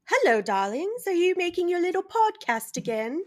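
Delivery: sassy lightly voiced lady; campy drag queen. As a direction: play the snooty owner lightly. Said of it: "Cat Lady imitated".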